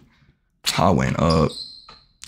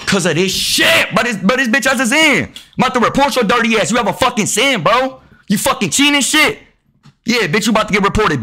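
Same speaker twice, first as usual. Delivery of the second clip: angry rant; 7 semitones higher than usual. Real, not acted. Gamer threatening to report a cheating opponent, heated rant. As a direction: rant at the cheater, fast and high.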